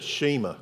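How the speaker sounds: Shema quoted as SHEE-muh; Southern-style "Shema", SHEE-muh; mispronounced Shema. Retold or imitated. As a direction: stretch the first vowel of Shema to EE. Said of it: "we don't say it with a southern accent".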